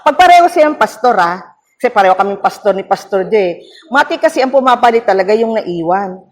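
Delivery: ordinary delivery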